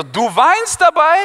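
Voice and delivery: put-on high slow shocked boy; incredulous Viktor. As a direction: stretch it out, shocked.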